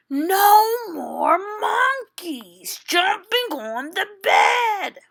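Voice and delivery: high, loud exasperated doctor yell; fed-up doctor shouting. Acted. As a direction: let the doctor finally lose it. Doctor's final warning, fed up.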